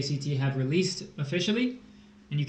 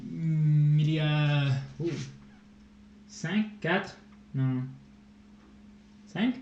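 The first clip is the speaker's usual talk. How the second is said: speaking French. Shown as a demonstration. Asked by chat, says a few words in French. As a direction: show off some French on request. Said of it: "tell us something in French".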